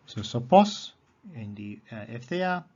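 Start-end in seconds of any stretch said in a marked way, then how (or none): 0.2-0.9 "sopos" with POS higher and louder; stress on last syllable. Shown as a demonstration. Teacher contrasting case forms, "sopos" stressed on POS. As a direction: hit the final POS of "sopos". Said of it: "the accent is upon the ultima".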